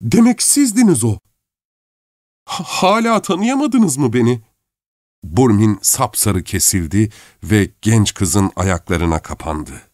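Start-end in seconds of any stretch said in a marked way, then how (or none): 0.0-4.4 high-pitched, overwrought realization; stunned recognition. A narrator's reading. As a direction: stammer out the shock in a high voice. Shocked recognition of her husband. (sarsılmış, tiz sesle)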